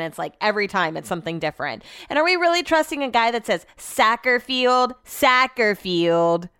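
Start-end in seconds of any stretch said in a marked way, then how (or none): 3.8-6.5 soccer with a flattened SACK vowel, drawn out; mocked pronunciation. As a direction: mimic him saying soccer as SACK-er. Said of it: "Listen to the way they said soccer".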